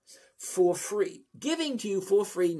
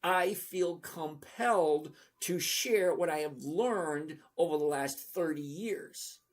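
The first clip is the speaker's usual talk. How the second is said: slow, deliberate, word by word; said slowly; slower than usual. Shown as a demonstration. To viewers who don't understand, slow and deliberate. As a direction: spell it out slowly so everyone gets it. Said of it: "Let me say it slowly".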